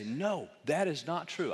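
drawn-out, insistent contradiction; slow, firm imitated denial; emphatic denial. Speaker mimics modern sceptics rejecting the claim, emphatic denial.

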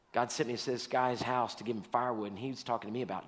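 ordinary talk

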